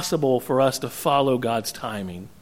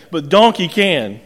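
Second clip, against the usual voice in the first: normal talking voice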